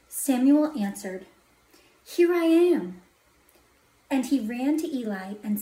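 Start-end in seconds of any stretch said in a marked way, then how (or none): 2.0-3.0 high, loud, eager answer; eager calling out. A narrator's reading. Boy Samuel answering a call, eager call. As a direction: call back readily to whoever summoned you.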